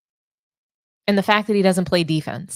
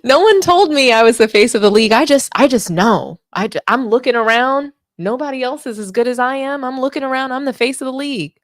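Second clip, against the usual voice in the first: mocking LeBron; 5 semitones higher, 5 dB louder than usual. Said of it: "he said no one told him that".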